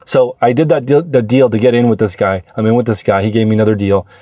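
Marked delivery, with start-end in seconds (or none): none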